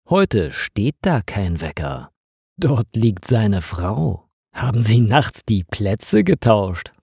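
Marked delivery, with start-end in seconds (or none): none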